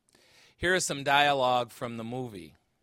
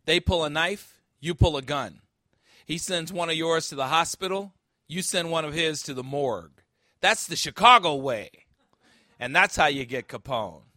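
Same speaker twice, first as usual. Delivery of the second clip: louder, tough street advice; forceful movie cop; 3 semitones higher, 8 dB louder than usual. Acted. Preacher voicing the cop's Chicago-way speech, louder and tough. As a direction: hammer each line home like a tough cop. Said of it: "Jim Malone says".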